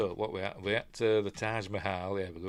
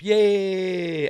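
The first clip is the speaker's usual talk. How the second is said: cheering; 9 semitones higher, 8 dB louder than usual. Real, not acted. Cheering Parliament blowing up.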